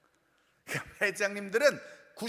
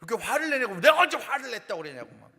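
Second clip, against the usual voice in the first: fast, louder irritated denial; irritated outburst; 2 semitones higher, 7 dB louder, faster than usual. Retold or imitated. Imitating an annoyed family member snapping at mom. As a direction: snap back defensively. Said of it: "왜 이렇게 짜증을 내냐면".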